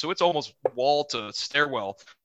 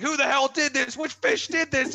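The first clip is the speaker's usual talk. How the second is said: mock angry yelling; 10 semitones higher than usual. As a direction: yell at the freshmen as if furious. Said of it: "we're, like, yelling at them".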